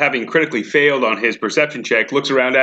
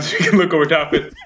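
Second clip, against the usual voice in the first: ordinary delivery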